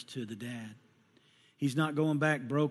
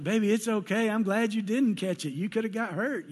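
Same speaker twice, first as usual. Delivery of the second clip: reassuring dad; 7 semitones higher, 4 dB louder than usual. Retold or imitated. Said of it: "I was like".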